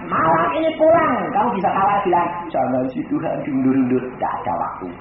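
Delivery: loud, fervent sermon voice; full-force preaching. Acted. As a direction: preach it hard to the congregation. (khotbah berapi-api)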